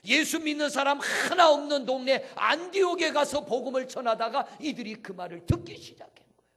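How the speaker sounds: high, booming sermon cry; impassioned pulpit shout; full-force preaching. Real, not acted. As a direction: proclaim it loudly, stretching the key words.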